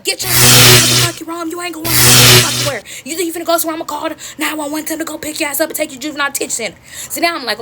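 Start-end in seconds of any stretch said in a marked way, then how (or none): 0.0-3.8 loud, high angry mother; acted furious scolding; mama snatching. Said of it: "She snatching me up". 3.8-6.8 fast, higher-pitched mama threat; acted scolding at speed; rapid threat.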